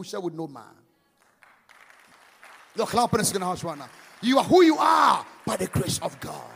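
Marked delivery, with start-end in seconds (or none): none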